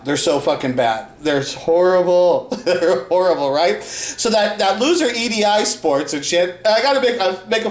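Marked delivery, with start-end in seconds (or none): none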